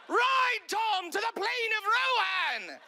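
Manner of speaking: high, loud, then slow epic command; horse lord. Retold or imitated. Mock horse lord sending Tom to Rohan, loud high epic. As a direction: ham it like a fantasy king's battle call. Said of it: "talking like a horse lord".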